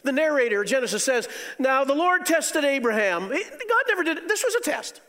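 high, loud pulpit proclamation; a loud, high sermon climax; fiery preaching. Acted. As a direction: preach it out with full force. Preaching at full force.

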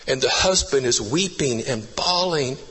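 ordinary talk, nothing marked

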